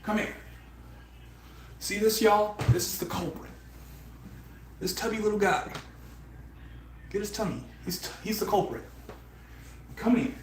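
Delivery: high, coaxing voice for the cat; pet talk. Real, not acted. Streamer coaxing the cat in a high pet voice. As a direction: sweet-talk the tubby little culprit.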